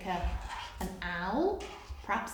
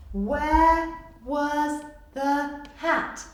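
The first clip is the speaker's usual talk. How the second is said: very slow answer read aloud; slow reveal; 4 semitones higher, 6 dB louder, much slower than usual. Shown as a demonstration.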